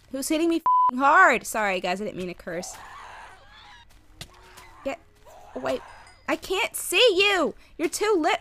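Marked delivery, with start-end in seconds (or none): 0.1-1.5 annoyed yell. Real, not acted. Getting hit repeatedly. 6.3-8.4 frustrated shout. Real, not acted. Frustrated at the screen.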